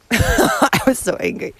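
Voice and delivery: laughing. Real, not acted. Laughs while recalling her anger.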